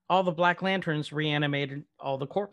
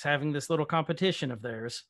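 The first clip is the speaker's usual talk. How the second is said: usual speaking voice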